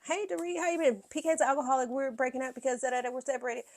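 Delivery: high mimic, blurting the news; mocking Erica. Mocking Erica overshare greeting Dorit.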